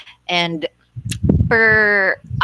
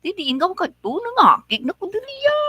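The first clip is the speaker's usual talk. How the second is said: high, fast Ilonggo scolding that still sounds sweet; an angry Ilonggo who can't help sounding sweet, fast; mad Ilonggo; 13 semitones higher, faster than usual. Retold or imitated. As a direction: Ilonggo anger, very high and quick. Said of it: "I wonder how they get mad".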